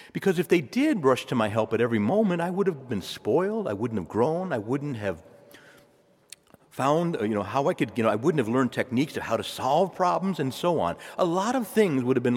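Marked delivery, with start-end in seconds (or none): none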